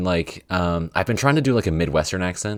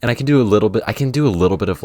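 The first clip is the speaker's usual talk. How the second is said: usual speaking voice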